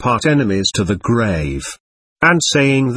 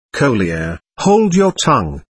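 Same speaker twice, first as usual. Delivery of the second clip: plain reading voice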